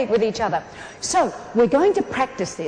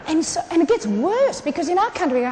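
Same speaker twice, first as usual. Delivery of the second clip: ordinary delivery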